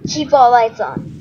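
normal talking voice

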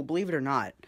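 ordinary delivery

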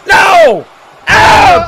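ordinary talk

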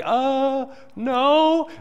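put-on a boy cornered by a bully, squeaking denial; terrified squeak. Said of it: "I'm like".

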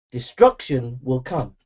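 normal talking voice

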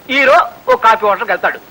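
ordinary delivery

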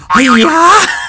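loud, high drawn-out hi-ya; karate cry. Pretend. Player trying an attack move, karate cry. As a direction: let rip a playful karate yell.

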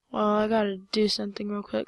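ordinary delivery

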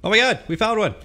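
high, loud excited yelp. Real, not acted. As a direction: shout it out with a thrill. Spotting a spider in game.

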